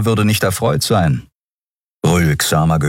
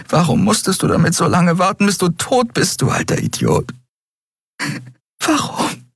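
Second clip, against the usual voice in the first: anguished crying; 12 semitones higher than usual. Acted. Grieving daughter at dead father.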